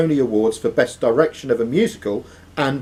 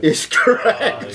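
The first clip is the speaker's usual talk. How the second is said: laughing; 8 semitones higher, much slower than usual. Real, not acted. Quizmaster laughing at answer.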